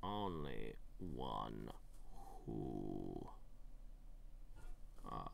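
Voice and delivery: low, soft and slow, savoring each word; hushed riddle. Acted. Reading the maze riddle to himself, low and slow. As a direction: read it like an ominous inscription.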